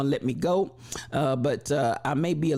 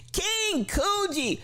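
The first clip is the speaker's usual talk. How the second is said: high, slow called-out name; much slower than usual. Acted. Host greeting King Coogee in chat, announcer call.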